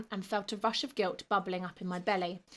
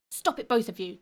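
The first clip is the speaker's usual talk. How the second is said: usual speaking voice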